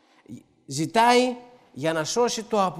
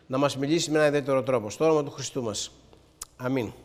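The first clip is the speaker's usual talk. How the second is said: spoken prayer; 4 semitones lower than usual. Real, not acted. Preacher leading the congregation in thanks before the reading, subdued prayer.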